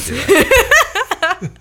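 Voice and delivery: high, slow, giggling; laughing. Real, not acted. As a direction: laugh through the words. Joking about doing an accent.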